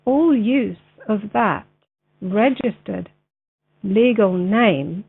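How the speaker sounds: slow, deliberate read-aloud with long pauses; slow, clear reading. Real, not acted. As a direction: read very clearly for a video, phrase by phrase. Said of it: "read it a little bit slower".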